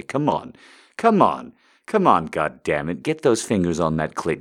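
loud, forceful commands; aggressive commanding voice. Acted. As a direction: bark the orders. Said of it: "You love the aggressive voice".

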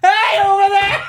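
high, loud, drawn-out shout; hammed-up call to someone far away; mock hailing. Imagining calling from the car window, exaggerated hollering. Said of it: "imagine if I'd wound down the window and said".

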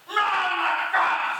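booming slow bellow to the gunners; pirate crying out. Acted. Pirate shouts to his gunners, a slow booming bellow. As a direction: roar the order across the deck. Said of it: "a pirate cried".

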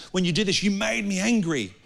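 mock-whiny blaming voice; mocking whine. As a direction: parrot the excuse like a sulky child. Mocking the blame-game excuse.

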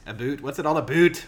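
mock Canadian 'a boot'; Canadian accent. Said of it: "with a Canadian".

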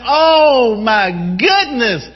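high, impassioned voice of God; slow, stretched opening, then high and eager; excited exclamation. Acted. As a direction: exclaim with yearning, pitch held high. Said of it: "He has excitement here".

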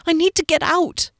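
high, desperate appeal; pleading. A narrator's reading. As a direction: beg frantically to get away. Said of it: "she pleaded".

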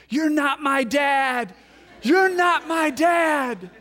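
high, loud, slow mock child's scream. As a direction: shout it like a furious six-year-old. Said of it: "She starts screaming at me".

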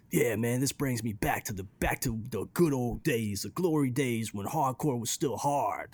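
put-on nostalgic old scenester voice; forty-five-year-old dude. Imagining older dudes hearing the track, mock nostalgic dude voice. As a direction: reminisce like an aging hardcore fan. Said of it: "forty-five-year-old dudes out there hearing this thinking".

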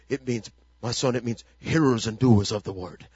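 put-on low-pitched African father's accent. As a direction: mimic an African dad solemnly advising his son. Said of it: "he said it in the African accent".

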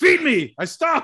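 loud, high theatrical demand; hammed-up vampire hunter. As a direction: cry it out with mock grandeur. Quoting the vampire hunter at dinner.

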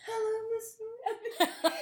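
high, slow, awed greeting; hushed high starstruck hello; strange starstruck whisper. Retold or imitated. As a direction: squeak it out, starstruck. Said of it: "this strange inharmonic whisper came out".

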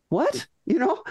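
high rising puzzled "What?"; quizzical what. Retold or imitated. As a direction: ask it baffled, looking up at the sky. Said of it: "looking quizzically at the sky and saying".

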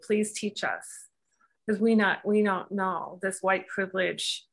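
normal talking voice

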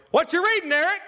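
high, loud, prying question; nosy brother-in-law. Retold or imitated. Brother-in-law prying at his Bible reading, high and loud. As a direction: call it out to catch him. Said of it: "he goes".

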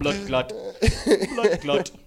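punchy mock Jamaican accent; Caribbean accent. Co-host echoing the comic, Caribbean accent. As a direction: trade it back in a Jamaican accent. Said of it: "very Caribbean towards the end".

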